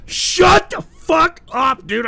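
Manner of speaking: slow furious high shout. Real, not acted. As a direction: roar it out, dragging each word. Host snaps at caller's request.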